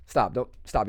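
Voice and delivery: usual speaking voice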